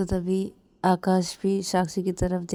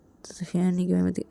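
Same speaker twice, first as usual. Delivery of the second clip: ordinary delivery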